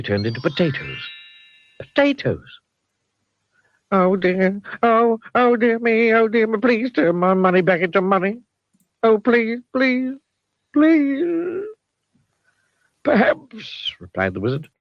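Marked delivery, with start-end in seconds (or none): 3.9-11.6 high, pleading Mister Mean voice; pleading miser. A narrator's reading. 13.0-13.9 high, rambling wizard voice; wordy wizard. A narrator's reading. Wizard answering.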